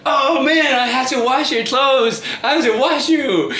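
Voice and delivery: high-pitched mock complaint; put-on high voice of a fussing aunt; exasperated auntie. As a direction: imitate an aunt wailing about the chore. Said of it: "my auntie was like".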